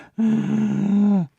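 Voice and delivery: high, hoarse gasp; noisy asthmatic inhale. Shown as a demonstration. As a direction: gasp in noisily between sentences. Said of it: "a bit like a sort of asthma".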